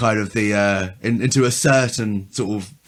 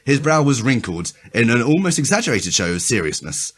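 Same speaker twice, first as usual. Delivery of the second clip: ordinary delivery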